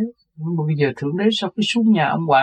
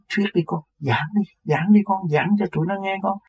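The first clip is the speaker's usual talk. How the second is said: raised, eager urging to preach; 6 semitones higher than usual. Retold or imitated. Old man urges his son to preach to the crowd, eager. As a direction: push him to speak, lightly imitated. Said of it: "Rồi ổng cứ kêu".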